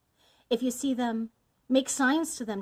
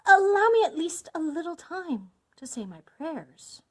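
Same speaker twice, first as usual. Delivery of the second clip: higher, louder begging wife; urgent plea in a raised pitch; tearful plea; 8 semitones higher, 7 dB louder than usual. A narrator's reading. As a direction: plead for a little time. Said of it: "eyes bathed in tears".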